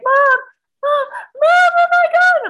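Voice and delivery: very high, loud calling out; imitated alarmed cry. Daughter finding cat fur, imitated alarmed cry for her mother. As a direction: shriek for Mom in mock alarm.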